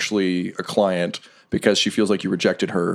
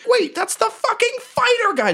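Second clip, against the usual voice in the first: much higher, squawking outraged boss; a cartoonish tyrant bursting in; furious brothel boss. Chaz storming into his brothel, shrill and outraged.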